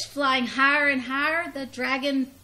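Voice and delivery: ordinary delivery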